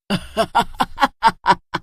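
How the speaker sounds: gloating witch laugh; witch cackle. Acted. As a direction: cackle in triumph. (जीत पर चुड़ैल की हँसी)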